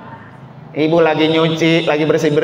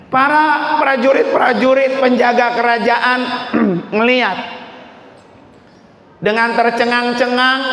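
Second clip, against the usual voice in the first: high, sustained preacher's narration; preaching; 7 semitones higher than usual. Acted. Preacher tells of the horrified palace guards, preaching. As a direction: tell it at full pulpit pitch, drawn out.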